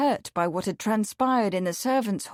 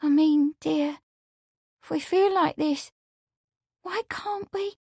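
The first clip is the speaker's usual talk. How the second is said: high, halting, tremulous plea; trembling; 7 semitones higher than usual. A narrator's reading. Amelia hints at marriage to Lawrence, trembling. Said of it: "she began tremblingly".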